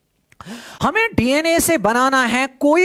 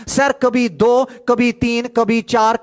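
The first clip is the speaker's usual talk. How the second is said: ordinary talk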